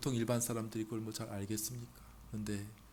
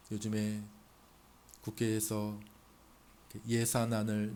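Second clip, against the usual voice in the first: ordinary talk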